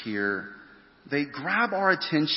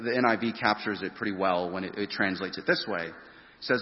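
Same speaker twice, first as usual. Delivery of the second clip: usual speaking voice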